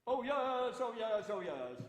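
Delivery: loud ringing proclamation call; town crier call. Shown as a demonstration. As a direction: bellow it to the whole square. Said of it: "I do have another cry".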